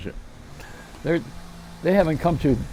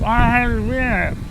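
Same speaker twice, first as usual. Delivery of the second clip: slow, higher lisping voice; lisping bar customer; 6 semitones higher, slower than usual. Retold or imitated. Joke customer answers bartender, slow lisping voice. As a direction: drag it out with a lisp. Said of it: "He says".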